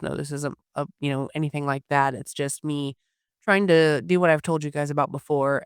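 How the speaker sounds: ordinary talk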